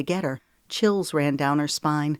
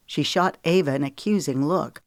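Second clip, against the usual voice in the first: plain reading voice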